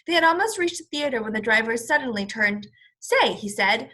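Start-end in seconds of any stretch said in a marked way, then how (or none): 3.0-3.3 a sharp high "Say" to get attention; sudden call. Acted. Taxi driver turns suddenly to Mister Popper, startled call.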